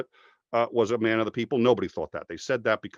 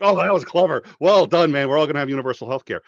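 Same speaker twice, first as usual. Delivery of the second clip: high, loud, gleeful mock cheer; mock thrilled; 5 semitones higher, 9 dB louder, faster than usual. Recalling his own delight at the healthcare trick, high and gleeful. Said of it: "I was like".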